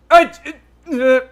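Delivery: ordinary talk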